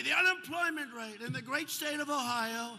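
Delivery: Sleepy Joe. Retold or imitated. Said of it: "Now, Sleepy Joe would say".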